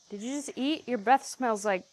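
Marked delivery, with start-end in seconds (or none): none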